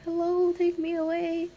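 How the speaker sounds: pretending to beg a cute boy, high and slow; mock swooning. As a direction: swoon at an imagined crush. Fantasy line to cute boy.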